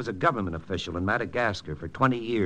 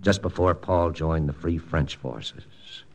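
low, hushed voice-over narration; story narration; 10 semitones lower than usual. A narrator's reading. Hero telling the listeners how he broke the news, low narration. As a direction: narrate it like a memory, low and inward.

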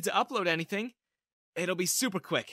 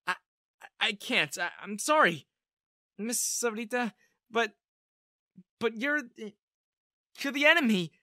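high, halting, stammering protest; agitated stutter, pitched well up; flustered refusal; 7 semitones higher, 5 dB louder than usual. A narrator's reading. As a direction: stumble through an apology, getting louder. Flustered refusal to hide a spy.

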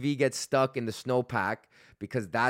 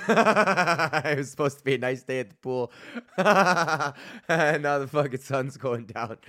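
laughing through the words, a bit louder; 4 semitones higher, 4 dB louder than usual. Real, not acted. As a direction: laugh it out at your own bad luck. Laughing at his own bad luck.

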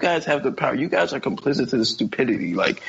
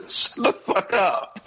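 laughing snap; 6 semitones higher, slower than usual. Acted. Acting parent to kid.

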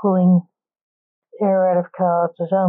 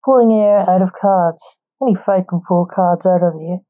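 ordinary delivery, nothing marked

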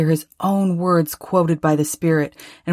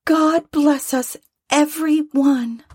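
Tiny Tim; 10 semitones higher than usual. A narrator's reading. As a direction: pipe it up high like a small boy blessing the family. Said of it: "said Tiny Tim".

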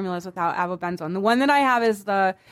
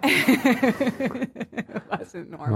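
helpless laughter through the words; laughing; much slower than usual. Real, not acted. Friend laughing at co-host's sunburn teasing, laughing. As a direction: repeat it while breaking up laughing.

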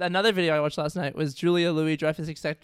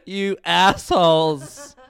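mock scolding; 3 semitones higher, 6 dB louder, slower than usual. Mock scolding of unlaughing listeners.

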